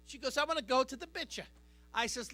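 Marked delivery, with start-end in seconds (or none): 0.4-1.5 quick mock Italian accent, mispronouncing beach; mother's Italian accent. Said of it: "that accent throws me for a loop".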